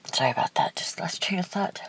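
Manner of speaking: whispering while collecting herself; whispered apology. Real, not acted. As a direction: murmur the apology under your breath. Apologizes after losing composure.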